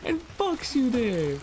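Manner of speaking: real high panic. Real, not acted. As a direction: beg it to stop in rising panic. Dragged into water, panicking.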